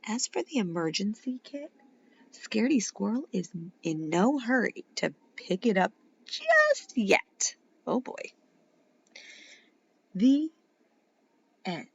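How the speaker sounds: put-on lively read-aloud for kids; animated children's story reading; story narration. A narrator's reading. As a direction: read it like a bedtime picture book. Reads picture book to children.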